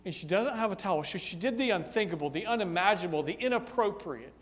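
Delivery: ordinary talk, nothing marked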